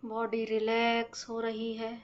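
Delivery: very slow, measured meditation voice with stretched-out words and long pauses; guided breathing meditation. Acted. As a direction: guide them slowly through each breath. (धीमी, शांत ध्यान कराने वाली आवाज़)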